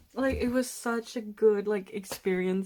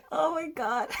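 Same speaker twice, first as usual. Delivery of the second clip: high, half laughing half crying; 8 semitones higher than usual. Real, not acted. Fan sees her signatures and laugh-cries. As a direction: gush through giddy tears.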